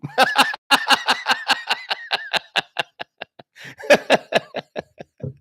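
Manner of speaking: wheezing laugh. Real, not acted. Laughing at a chat joke.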